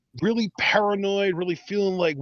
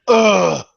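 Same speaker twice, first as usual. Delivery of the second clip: mock grunt; 4 semitones higher, 10 dB louder than usual. Imitating Triple H.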